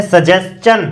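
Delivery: "सजेशन" as sa-jes-chan; ch sound. Shown as a demonstration. Teacher giving the exam answer, "सजेशन" with clear ch. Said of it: "इसमें च है या श है".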